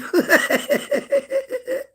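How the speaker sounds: a high, bursting cackle; high cackling laugh. Real, not acted. Streamer laughing at her own false teeth joke, high cackle.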